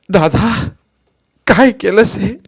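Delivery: loud, high grief-stricken cry; anguished cry. A narrator's reading. Finding brother dead. (दुःखाने Tahoe)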